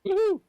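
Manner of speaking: high, loud "woohoo"; excited whoop. Real, not acted. Cheering at being allowed to dash.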